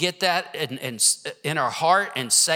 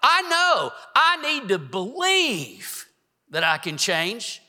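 preaching; 5 semitones higher than usual. Acted. Preaching rises again.

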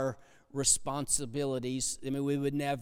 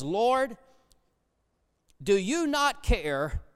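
upset Martha; 6 semitones higher, 4 dB louder, slower than usual. Retold or imitated. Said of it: "Martha comes in and she's all upset".

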